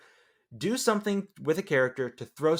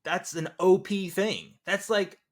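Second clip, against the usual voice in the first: rising to a loud, high incredulous rant; mock outrage at a plot hole, louder and higher to the end; exasperated disbelief; 9 semitones higher, 8 dB louder than usual. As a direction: build from baffled to fed-up outrage. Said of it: "And I was just like".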